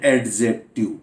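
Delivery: एडजेक्टिव with the D sounded before the J; wrong pronunciation. Shown as a demonstration. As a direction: say "ad-jective" with an audible D. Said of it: "यानी डी को pronounce करते हैं".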